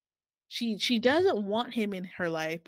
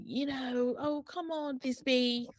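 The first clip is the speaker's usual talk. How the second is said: put-on higher-pitched British boyfriend brushing her off; British accent; 6 semitones higher, slower than usual. Boyfriend to Thisbe when challenged, in a British accent. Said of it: "I'm gonna do a British accent".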